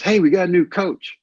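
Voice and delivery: put-on high, loud teasing kid; a young player heckling the new guy; mocking kid. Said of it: "he goes".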